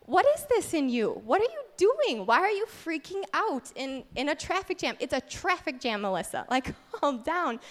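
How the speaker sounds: incredulous scolding that settles down. Retold or imitated. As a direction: act out an exasperated reprimand. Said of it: "and the Lord said".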